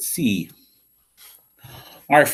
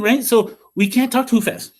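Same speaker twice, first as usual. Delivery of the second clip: ordinary delivery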